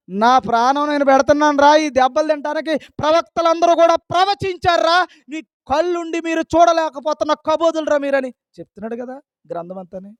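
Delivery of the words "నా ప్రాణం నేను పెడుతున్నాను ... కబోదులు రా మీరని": loud, high, impassioned rebuke; exasperated Jesus. Acted. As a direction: scold the weepers as an exasperated Jesus. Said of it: "ఆయన సిలువ పక్కనెట్టి దాన్ని జారేసుకుని అన్నాడు". (గొంతు పెంచి యేసులా ఆవేశంగా మందలింపు)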